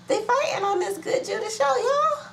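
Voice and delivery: very high, held-note mock lament; high-pitched wail. Host lamenting fighting on the show, high mock wail.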